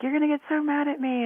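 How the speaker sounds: high worried imitation of the caller; anxious lady. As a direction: squeak it out like the nervous caller expecting trouble. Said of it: "she's like".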